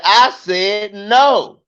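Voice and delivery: put-on slow, firm little boy's refusal; firm toddler no. Comic mimicking his toddler son refusing a stranger. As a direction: put your foot down, slowly.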